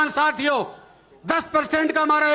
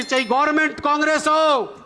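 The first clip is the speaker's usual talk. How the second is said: ordinary talk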